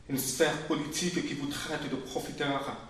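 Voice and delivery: ordinary talk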